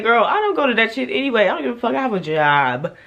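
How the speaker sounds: high, loud defiant brush-off; loud high-pitched attitude; sassy outburst. Retold or imitated. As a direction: fire it back high and loud. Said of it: "I said".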